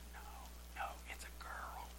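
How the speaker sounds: soft, unvoiced whisper; a hushed aside at the altar; whispered correction. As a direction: hiss it discreetly from behind. Man behind corrects the pastor.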